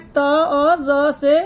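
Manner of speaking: very slowly, each sound separate; slow spelling-out. Shown as a demonstration. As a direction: spell त and र apart for children. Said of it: "त्र किससे बना है?". (धीरे-धीरे अलग करके बोलें)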